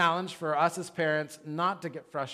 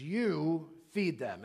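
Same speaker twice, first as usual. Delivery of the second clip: YOU stretched out and stressed, then "feed them" slower; emphatic stern command; much slower than usual. Retold or imitated. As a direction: lean hard on a drawn-out YOU. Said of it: "it's a very emphatic "you".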